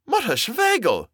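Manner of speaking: very high, astonished cry; high-pitched exclamation of recognition; shouted greeting. A narrator's reading. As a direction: call out in delighted surprise. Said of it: "he shouted".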